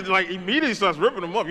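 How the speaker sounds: ordinary delivery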